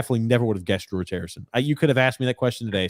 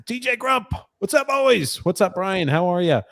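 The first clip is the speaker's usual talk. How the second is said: much higher, bouncy DJ-style shout-out; hyped-up greeting voice; 8 semitones higher than usual. Acted. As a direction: read it like an excited radio host greeting the boys. Reading a viewer's greeting aloud.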